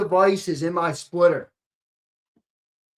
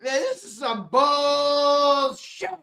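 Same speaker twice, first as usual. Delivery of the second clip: slower and higher, stretching each word; slow drawn-out repeat; 7 semitones higher, much slower than usual. Acted. Streamer repeating his complaint to chat, slow drawn-out. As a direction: drag it out for effect.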